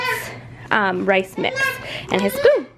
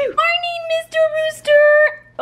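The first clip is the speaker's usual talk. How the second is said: high sing-song greeting; 3 dB louder, slower than usual. Acted. Greeting toy to amuse toddler.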